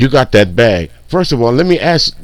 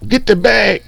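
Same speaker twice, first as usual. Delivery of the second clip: mock slang; 9 semitones higher than usual. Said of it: "dumbest motherfucking sayings".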